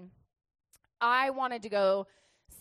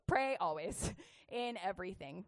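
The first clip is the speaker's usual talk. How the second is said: ordinary delivery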